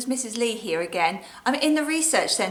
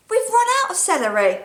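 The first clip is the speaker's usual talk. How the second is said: cheeky reply; 9 semitones higher, 4 dB louder, faster than usual. Acted. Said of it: "And I say".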